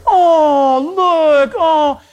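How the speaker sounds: high, drawn-out mock adoring coo; cooing passerby. Next person walking by the baby, cooing high. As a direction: imitate someone melting over a baby.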